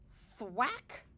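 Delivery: ordinary delivery, nothing marked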